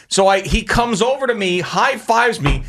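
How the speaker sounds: ordinary delivery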